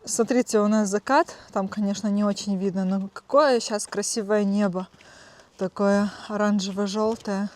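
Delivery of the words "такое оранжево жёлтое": ordinary talk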